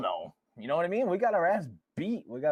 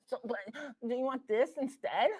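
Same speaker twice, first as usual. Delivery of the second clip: coaxing parent; 8 semitones higher than usual. Retold or imitated. Imitating soft permissive parent.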